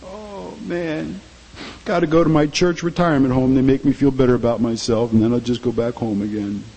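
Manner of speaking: sighing low lazy churchgoer voice; lightly voiced bored churchgoer; weary complacent churchgoer. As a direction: sigh it like someone dragging to church for comfort. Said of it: "Some Christians think that way".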